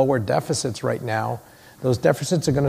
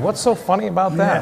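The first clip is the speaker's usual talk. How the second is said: laughing puzzlement; 6 semitones higher than usual. Retold or imitated. Recalling his sixth-grade confusion, laughing and higher. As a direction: laugh through the puzzled question. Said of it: "I can remember sitting there going".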